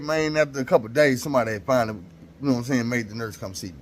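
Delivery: ordinary talk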